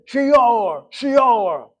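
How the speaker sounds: Shior called out loud and high in a Middle Eastern Hebrew accent, throaty; old Middle Eastern Hebrew. Shown as a demonstration. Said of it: "The way Hebrew was once spoken".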